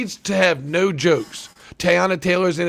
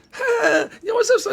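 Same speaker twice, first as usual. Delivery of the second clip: much higher, laughing mock street greeting; Shorty caricature. Mocking the producer's exaggerated Shorty caricature to his cohost. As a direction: ham up a cartoonish Shorty impression. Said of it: "it's like me going like, instead of me".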